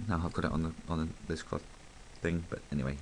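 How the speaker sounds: ordinary delivery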